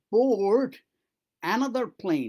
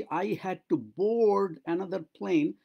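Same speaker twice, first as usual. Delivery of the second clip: very slow, drawn-out model sentence; slow demo; much slower than usual. Shown as a demonstration. Teacher demonstrates the sentence very slowly. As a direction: stretch every word for learners. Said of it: "যখন আমি বলছি".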